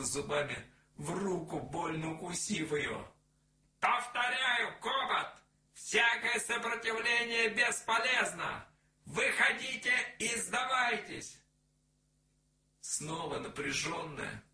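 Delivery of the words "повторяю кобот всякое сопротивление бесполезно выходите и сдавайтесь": loud, high megaphone announcement, repeated; outdoor loudspeaker. A narrator's reading. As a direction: repeat the warning over a loudspeaker. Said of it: "голос из громкоговорителя". (повторное объявление через громкоговоритель)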